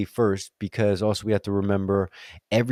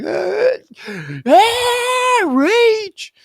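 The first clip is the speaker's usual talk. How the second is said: much higher, louder cartoon rage cry; mock rage; 7 dB louder, much slower than usual. When his insecurities get triggered, a much higher, louder mock rage. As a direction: ham up an exploding tantrum. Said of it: "and I'm like".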